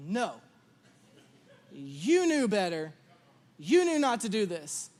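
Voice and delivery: low, slow, stern refusal; measured scolding of a child; strong, firm parent. Retold or imitated. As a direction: hold firm like a strict parent. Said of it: "And I was strong".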